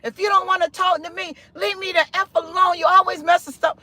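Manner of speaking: high-pitched, quick mock telling-off; mild, censored cussing fit; retold angry cursing. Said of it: "I got mad and I start cursing".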